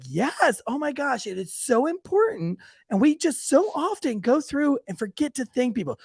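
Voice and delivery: high, gushing imitation of a kids' teacher; children's church teacher. Teacher praising a child's thanks, mock-gushing. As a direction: overdo the sweet, earnest encouragement. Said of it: "And she's like".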